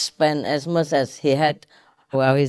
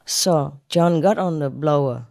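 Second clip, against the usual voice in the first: usual speaking voice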